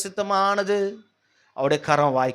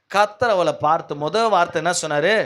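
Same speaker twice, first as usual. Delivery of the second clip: ordinary delivery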